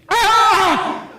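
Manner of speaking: sudden high yelp of pain with a laugh. Retold or imitated. Kid hit on the head, high sudden yelp. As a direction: cry out as the slide hits your head.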